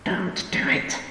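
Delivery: loud, unvoiced whisper of alarm. A narrator's reading. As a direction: hiss the warning in alarm. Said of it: "she whispered loudly in alarm".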